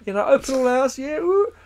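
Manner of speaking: loud, high mock enthusiasm; hammed-up fogey whooping; old dickheads reminiscing. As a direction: cheer for old telly like a nostalgic bore. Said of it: "We're a bunch of old dickheads".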